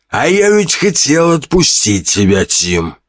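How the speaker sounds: выше, наигранно сочувственно, чужим голосом; приторная жалость злодея; преувеличенное сочувствие. A narrator's reading. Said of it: "с преувеличенным сочувствием сказал Химера". (главарь бандитов фальшиво жалеет Тима, сладко и свысока)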